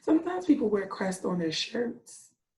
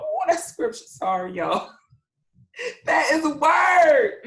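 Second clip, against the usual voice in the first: excited exclaim; 7 semitones higher, 7 dB louder than usual. Real, not acted. Teacher excited by the scripture, loud exclaim. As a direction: call it out like an amen. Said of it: "excited by the scripture".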